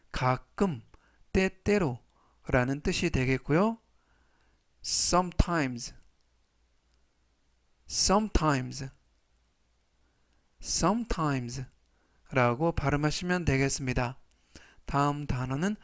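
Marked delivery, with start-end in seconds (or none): 4.8-11.7 slow, drawn-out sometimes; slow repeat-after-me. Shown as a demonstration. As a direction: stretch each syllable so students can echo. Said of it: "제가 천천히 세 번씩 읽어드리고요".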